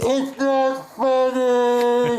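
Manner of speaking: high, loud, drawn-out cry of pain; crying yell. Retold or imitated. Man with wasabi up his nose wails at his friend, high and loud. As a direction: wail it out through the burning sting. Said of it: "Like, crying 'cause it burned so bad".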